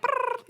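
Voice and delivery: very high playful trilled bird noise; a high chirpy brrr of a nesting bird. Retold or imitated. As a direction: flutter like a restless bird. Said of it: "You know, I'm like".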